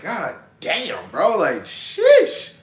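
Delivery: self-admiring sheesh. Retold or imitated. Admiring self in Target mirror.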